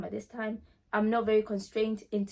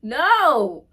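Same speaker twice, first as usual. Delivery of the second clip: emphatic no; 4 semitones higher, 10 dB louder than usual. Retold or imitated. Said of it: "I was like".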